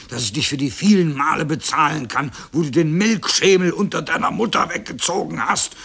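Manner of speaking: angry cow. A narrator's reading. Eldest cow avenging the mother, raging. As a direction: pile up the accusations indignantly. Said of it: "sie war am zornigsten".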